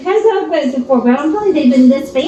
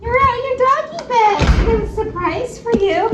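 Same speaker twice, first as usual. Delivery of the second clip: pet talk; 8 semitones higher than usual. Real, not acted. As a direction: coo at the puppy in a lifted voice. Talking to dog at home.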